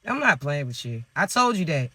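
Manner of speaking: dropped low and soft, half under the breath; a low, quiet mock threat; low muttered warning. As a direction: mutter it down low like a weary aside. Repeated teasing warning.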